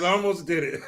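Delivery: words broken by laughter; laughing, almost did it. Real, not acted. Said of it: "I almost said it with a straight face".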